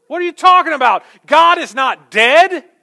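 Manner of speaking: full-voiced scolding yell, acting an exasperated husband; screaming. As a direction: bellow it in outrage, stretching the last words. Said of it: "started screaming at her".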